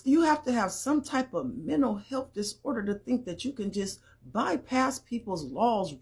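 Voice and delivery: ordinary talk, nothing marked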